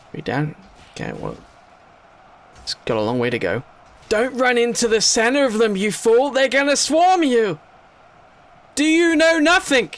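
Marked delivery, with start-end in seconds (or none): 4.1-9.9 high, loud exasperated telling-off; a mock-furious shout at a doomed unit; mock scolding yell. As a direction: yell at the foolish fighter. Scolding game unit charging in.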